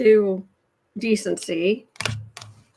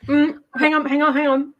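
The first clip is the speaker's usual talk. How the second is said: talking through a mouthful; words round a bite of food; mouth full; 4 semitones higher than usual. Real, not acted. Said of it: "talking with my mouth full".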